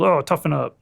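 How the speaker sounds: usual speaking voice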